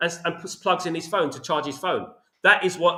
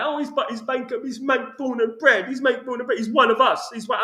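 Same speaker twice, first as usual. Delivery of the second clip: mocking fans; 7 semitones higher than usual. Mimicking United fans praising their local owner, mock proud. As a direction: parody the fans who defend him. Fans defending Ratcliffe, mocked.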